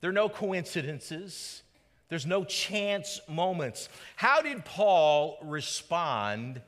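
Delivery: usual speaking voice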